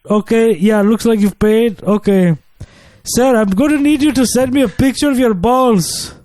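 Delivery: put-on call-center agent in a lightly done African accent; mock African accent, slightly high. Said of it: "Dude, you just sounded perfect".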